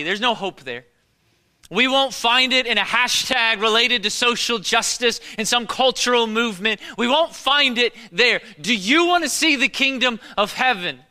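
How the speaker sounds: loud, high, driving closing appeal; full-force preaching. Acted. Pastor's closing argument to the church, fervent preaching. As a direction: build to a fervent call.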